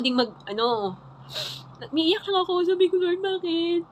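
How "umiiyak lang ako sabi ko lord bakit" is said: tearful. Real, not acted. Telling how she broke down in church, tearful. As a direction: voice lifted and strained with tears. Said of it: "umiyak ako".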